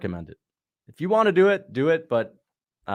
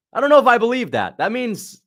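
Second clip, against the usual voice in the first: pitched way up, louder, doubting; incredulous reaction to a chat message; surprised disbelief; 11 semitones higher, 6 dB louder than usual. Real, not acted. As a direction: scoff at it in disbelief. Reacting to chat's claim.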